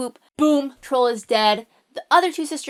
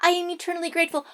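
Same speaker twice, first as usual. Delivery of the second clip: rushed gratitude; 5 semitones higher, 3 dB quieter, much faster than usual. Acted. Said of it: "the princess is like".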